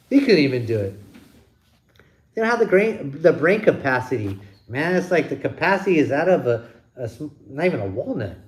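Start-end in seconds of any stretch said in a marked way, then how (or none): none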